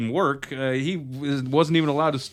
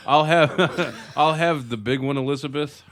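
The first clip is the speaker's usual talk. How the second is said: giggling through a pretend order; laughing mock order; 4 semitones higher, 3 dB louder than usual. Ordering from a sitcom-themed stand, laughing. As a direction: try to order but keep cracking up.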